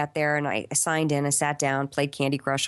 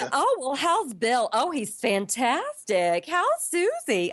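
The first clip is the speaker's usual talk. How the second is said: higher, slow sing-song small talk; drawn-out fake-friendly catching up; gushing fake-sweet actress; 7 semitones higher, slower than usual. Actresses catching up before an audition, mock gushing higher voice. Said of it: "with that tone of voice".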